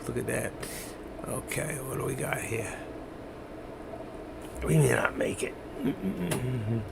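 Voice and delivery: soft breathy whisper; hushed, barely voiced. Real, not acted. Whispering while watching the chart.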